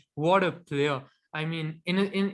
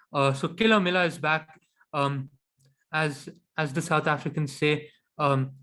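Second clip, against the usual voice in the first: usual speaking voice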